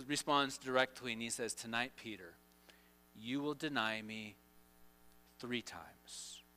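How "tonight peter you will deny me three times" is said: low, hushed pronouncement; quiet, measured foretelling; solemn prediction. Acted. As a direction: drop soft and low to break grave news. Voicing Jesus foretelling the denial.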